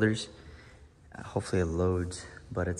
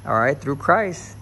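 preacher climax; 4 semitones higher, 5 dB louder than usual. Acted. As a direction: lean into the church call-and-response. Preaching to viewers.

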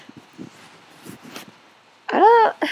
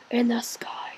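whisper; 2 semitones lower, 7 dB quieter than usual. Real, not acted. Whispered aside while playing.

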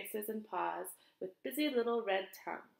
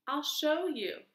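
strange voice; 5 semitones higher, 4 dB louder, slower than usual. Acted. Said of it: "said the strange voice".

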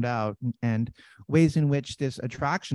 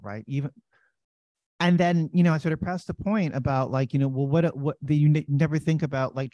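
ordinary talk

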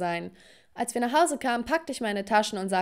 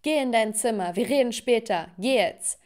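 ordinary delivery, nothing marked

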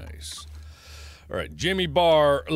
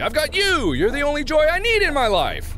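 doting; 11 semitones higher, 4 dB louder than usual. Pretend. Doting on companion Rupert.

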